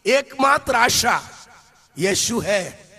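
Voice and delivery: slow, ringing declaration from the pulpit; preaching proclamation. Acted. Preacher declaring to the congregation, slow and ringing. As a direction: proclaim it to the whole hall.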